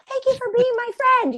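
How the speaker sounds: squeaky thanks; high, grateful gush. Retold or imitated. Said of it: "I was like".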